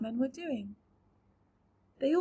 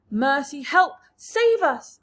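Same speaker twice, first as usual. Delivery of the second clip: terrified cry; 3 semitones higher, 10 dB louder, slower than usual. A narrator's reading. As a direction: cry for mercy. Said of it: "cried the Ladybird".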